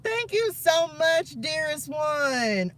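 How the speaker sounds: real emotional gratitude, voice up high; tearful thanks. Real, not acted. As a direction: thank them while still close to tears. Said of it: "don't make me cry".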